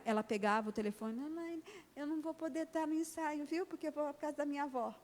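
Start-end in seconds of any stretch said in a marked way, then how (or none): 1.1-5.0 put-on higher and softer childish voice; a small girl politely excusing herself, softly; little girl on phone. (menininha ao telefone, voz aguda e baixinha)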